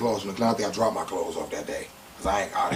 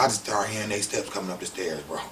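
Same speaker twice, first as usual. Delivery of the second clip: ordinary delivery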